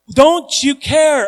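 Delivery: high, loud and slowed; desperate cry. Retold or imitated. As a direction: wail it at God. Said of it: "In other words".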